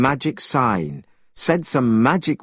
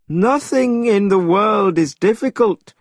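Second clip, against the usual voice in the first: the immortal; 8 semitones higher, 3 dB louder than usual. A narrator's reading. Immortal's held voice.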